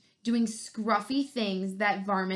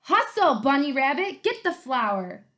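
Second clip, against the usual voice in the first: higher, louder bossy bark; barking orders; 4 semitones higher, 6 dB louder than usual. Acted. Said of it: "barking orders".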